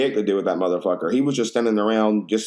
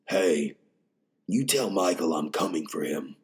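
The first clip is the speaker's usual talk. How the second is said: lightly voiced gruff heroine threat; a hint of a grim movie threat, softer; mild mock of a tough action heroine; imitating Jamie Lee Curtis; 5 semitones lower, 6 dB quieter than usual. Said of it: "she's just".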